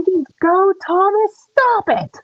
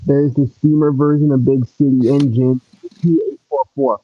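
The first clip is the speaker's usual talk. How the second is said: much lower put-on engine voice; deep engine voice. Child voicing big brother engine teasing little brother, deep and low. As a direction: rumble it out like a big old steam engine.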